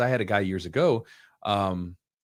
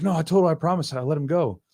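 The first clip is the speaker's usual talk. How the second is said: higher-pitched mock defensive protest; 7 semitones higher than usual. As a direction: imitate a student pleading his case. Student defends himself to coach.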